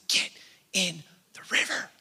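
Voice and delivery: imitated whisper, word by word; retold inner whisper. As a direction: whisper it as a quiet, insistent command. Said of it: "this, like, whisper inside of me".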